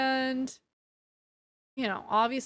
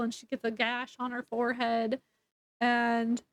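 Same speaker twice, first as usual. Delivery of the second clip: ordinary talk, nothing marked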